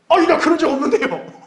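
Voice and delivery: very high, hammed-up squeal of protest; mock flustered denial. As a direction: act cornered and deny it, on the edge of laughter. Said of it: "그때 주님이 그렇게 물을 수도 있어요".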